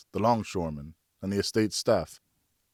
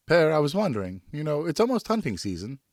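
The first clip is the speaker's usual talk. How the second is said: put-on much higher, eager young son; boy's voice; 7 semitones higher than usual. Son asks his father for a moment, in a high boyish voice. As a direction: voice the boy hopeful and polite.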